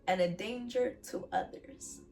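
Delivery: ordinary talk, nothing marked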